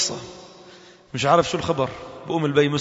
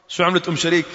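ordinary talk, nothing marked

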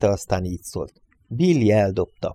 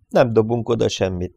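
plain reading voice

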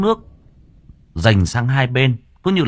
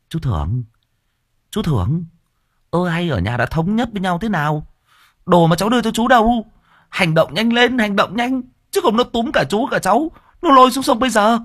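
put-on urgent young man; 12 semitones higher than usual. Nephew urging the scared uncle.

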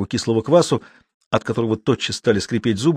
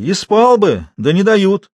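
higher, louder objection; emphatic complaint of the old watchman; meaningful retort; 9 semitones higher, 5 dB louder, slower than usual. A narrator's reading. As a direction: object with weight, pitched up. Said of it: "значительно возразил Матвей".

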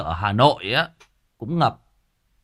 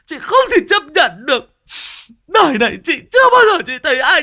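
high, loud, tearful editor's complaint; quoting the crying editor; 11 semitones higher, 7 dB louder than usual. Retold or imitated. Retelling the old editor sobbing that she was insulted, higher and louder. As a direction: play the wounded editor wailing her hurt. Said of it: "bà bảo chị tổn thương lắm".

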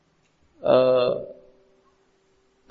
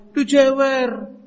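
put-on slow, high little boy's complaint; crying child; 9 semitones higher, slower than usual. Small boy telling his father he was hurt, tearful. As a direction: whimper it like a hurt small kid. Child reports being ear-pulled.

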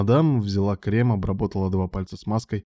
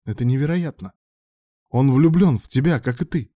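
raised, high-pitched outburst; exclaiming; 6 semitones higher, 5 dB louder than usual. A narrator's reading. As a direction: cry out in disbelief. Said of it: "воскликнула мадам".

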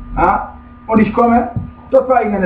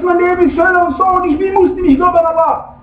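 high-pitched imitated protest; insistent, pitched-up grievance; mock complaint; 8 semitones higher than usual. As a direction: act out the objector pleading his case. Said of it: "Du sagst".

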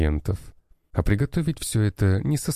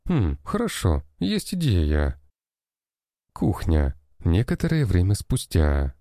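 plain reading voice

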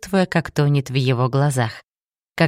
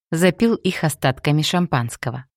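usual speaking voice

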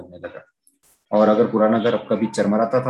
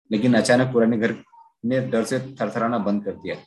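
usual speaking voice

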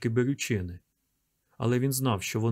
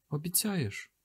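put-on high, soft child's question; small frightened boy; hushed child asking; scared boy; 6 semitones higher, 6 dB quieter, slower than usual. A narrator's reading.

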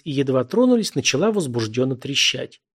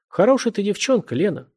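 higher opening, a touch of envy; envious sigh; 8 semitones higher than usual. A narrator's reading. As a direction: breathe it out with mild envy. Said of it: "выдохнул с завистливой интонацией". (с лёгкой завистью, на выдохе)

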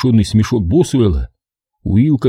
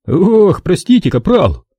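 higher, louder mock-sorry; fake apology; 9 semitones higher, 3 dB louder than usual. A narrator's reading. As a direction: overplay the apology. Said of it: "притворно извинился он".

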